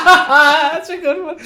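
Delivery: squealing with laughter; high laughing voice. Real, not acted. Laughing hard at the joke.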